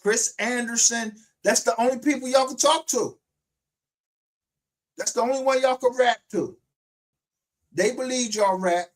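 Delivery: high, loud, emphatic jeering; real angry taunt. Real, not acted. Host accusing rappers of talking to detectives, loud taunt. Ranting at rappers, mocking them.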